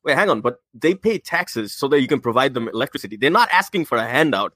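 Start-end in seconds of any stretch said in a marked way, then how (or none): none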